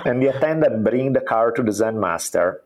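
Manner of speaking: usual speaking voice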